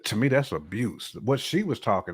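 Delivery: ordinary delivery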